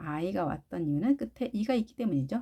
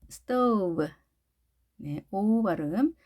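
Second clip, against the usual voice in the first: ordinary delivery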